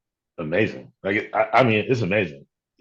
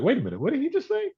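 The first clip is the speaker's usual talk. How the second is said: high, quick double take; delayed bewilderment; faster than usual. Retold or imitated. Insulted person realising too late what was said, mock bewilderment. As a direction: imitate someone baffled, pitched way up and rushed.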